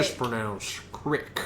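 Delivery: low, slow "crick" with a short i; mock-solemn imitation, CRICK said slowly; imitated man. Friend mimics the TikTok man saying crick, low imitation. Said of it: "A man saying".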